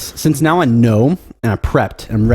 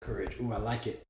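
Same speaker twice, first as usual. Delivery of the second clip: quiet; 21 dB quieter than usual. Real, not acted. Whispering the word courage.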